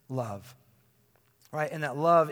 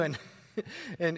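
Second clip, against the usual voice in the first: ordinary talk, nothing marked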